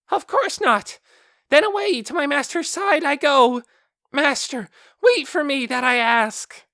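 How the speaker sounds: a big magical beast begging to be taken along; high, loud, earnest creature cry; shrill beast calling. Acted. Beast calls master.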